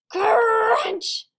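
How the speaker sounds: high, loud crunching shout. Acted. Sammy bites his friends.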